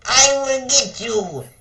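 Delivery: high, loud threatening yell; villain's yell. Acted. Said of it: "yelled Scumlord".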